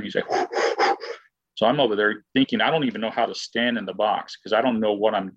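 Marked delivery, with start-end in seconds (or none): 0.3-1.1 hard wordless puffs of breath; imitated huffing and puffing. Angry batter sitting down in the dugout, huffing and puffing. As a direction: huff and puff like a fuming player. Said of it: "he's huffing and puffing".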